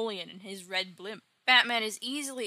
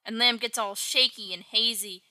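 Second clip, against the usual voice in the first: normal talking voice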